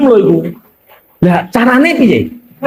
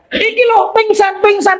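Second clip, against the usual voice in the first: high alarmed pingsan pingsan; panicked cry; 11 semitones higher than usual. Acted. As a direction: blurt it out in a fright, pitched high. Frightened report of a fainting.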